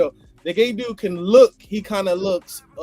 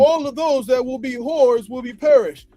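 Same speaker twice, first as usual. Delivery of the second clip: preaching; 5 semitones higher, 3 dB louder than usual. Retold or imitated. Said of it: "It says".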